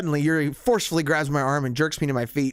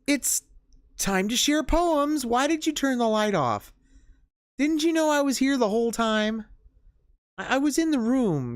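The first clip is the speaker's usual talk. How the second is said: put-on much higher, slow creepy shy girl; higher, eerie girl announcing poem time; eerie Yuri; 10 semitones higher than usual. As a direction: hover in the dark like an unsettling girl. Voices Yuri in the dark.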